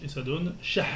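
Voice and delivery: ordinary talk, nothing marked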